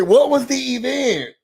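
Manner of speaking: high, exasperated question; baffled repeat. Real, not acted. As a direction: demand an answer, high-pitched.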